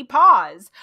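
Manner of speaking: the word pause thrown out loud. Shown as a demonstration. Narrator sounding out the misheard word, loud. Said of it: "pause was really P A U S E".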